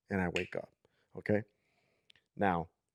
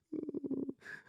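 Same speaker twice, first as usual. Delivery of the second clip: high, soft mock whimper; imitated whimpering; 10 dB quieter than usual. Imitating the little girl crying in the rain, whimpering. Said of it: "like that, just whimpering".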